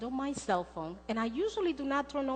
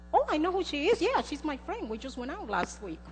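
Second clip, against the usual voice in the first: higher, louder eager gush; fake familiarity; 7 semitones higher, 4 dB louder than usual. Almost lying to the doctor about knowing her, high and eager. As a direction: pretend she's your best friend. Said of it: "I almost said".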